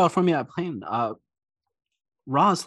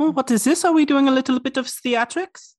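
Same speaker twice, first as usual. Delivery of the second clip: excited high voice; 14 semitones higher, 6 dB louder than usual. Acted. NPC Apolline excited.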